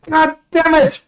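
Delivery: high, loud furious guard; guard hit by a firebolt, shouting in pain; burned guard yelling. Acted. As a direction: scream orders while on fire. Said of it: "and he's like".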